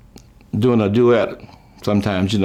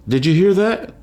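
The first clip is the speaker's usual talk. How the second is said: startled; 8 semitones higher than usual. Retold or imitated. Mom startled in graveyard.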